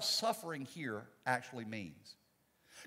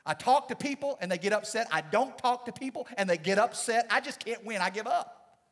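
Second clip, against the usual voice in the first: louder, higher frustrated complaint; hammed-up exasperated rant; mock upset outburst; 4 semitones higher, 6 dB louder than usual. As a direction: vent it like you can't win. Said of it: "you get upset and go".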